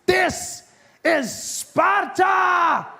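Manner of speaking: high, slow, roaring Sparta line; movie quote shout. Retold or imitated. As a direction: bellow it like the movie hero. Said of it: "watch my favorite movies".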